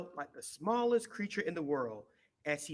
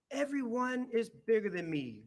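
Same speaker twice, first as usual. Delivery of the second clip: grumbled; 7 semitones higher, 4 dB louder than usual. A narrator's reading. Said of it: "grumbled Little Hippo to himself".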